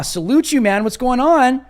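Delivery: loud, high, excited greeting; hearty shout-out. Real, not acted. Hailing a known super chat sender.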